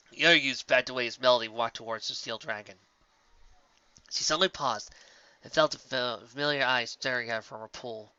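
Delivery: plain reading voice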